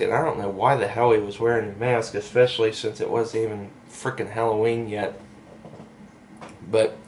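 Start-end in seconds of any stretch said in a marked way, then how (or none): none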